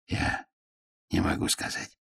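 soft breathy whisper; barely voiced, hushed dwarf. A narrator's reading. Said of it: "прошептал гном".

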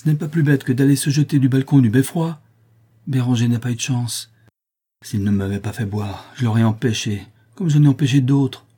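anger that swells then dips and flares again; angry tone. A narrator's reading. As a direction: let the resentment flare up. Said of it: "d'un ton soudain de colère".

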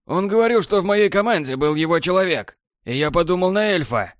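high, shaken explanation; shaken excuse. A narrator's reading. Borland explaining his mistake, high shaken voice. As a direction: justify yourself, rattled.

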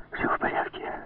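hushed, urgent whisper; взволнованный шёпот. A narrator's reading. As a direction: whisper it with suppressed agitation. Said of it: "взволнованно прошептал он". (взволнованно, еле слышным шёпотом)

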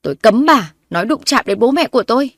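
loud, angry forbidding; loud warning. A narrator's reading. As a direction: shout it as you push her. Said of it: "mà lớn tiếng".

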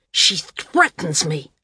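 usual speaking voice

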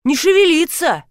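high-pitched alarmed cry; startled mock yell; shouting. A narrator's reading. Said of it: "закричал Ефим".

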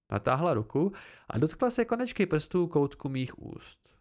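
ordinary delivery

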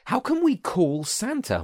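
high, slow, mincing voice; a high, fussy minced question. A narrator's reading. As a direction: mince the words up high and prissy. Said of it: "minced Jack".

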